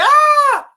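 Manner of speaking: very high, loud imitated shriek; a startled mother's shriek, hammed up; imitating mum's scream. Son mimicking his startled mum's loud high scream. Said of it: "She screamed and she went".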